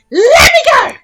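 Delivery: very high, loud demand; excited gnome shout. Acted. Grizzled gnome yelling at the launch crew, very high and loud. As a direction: scream it like a gnome desperate to fly.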